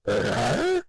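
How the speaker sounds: strangled high zombie croak; zombie grunt. A narrator's reading. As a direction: grunt like a dim undead girl. Zombie girl grunting on phone.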